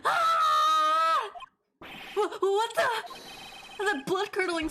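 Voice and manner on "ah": sudden high shrieking scream. Acted. Miss Tonim screaming in the snowy courtyard. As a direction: let out a blood-curdling scream.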